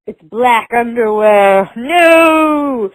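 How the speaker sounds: slow and loud, building to a shocked No!; scandalized grandma. Retold or imitated. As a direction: play the prim grandma horrified at the underwear. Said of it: "the grandma's like".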